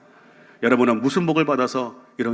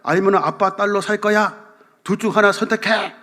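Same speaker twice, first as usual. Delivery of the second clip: angry father's ultimatum; 7 semitones higher than usual. Retold or imitated. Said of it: "아빠가 이렇게 말했다고 합니다".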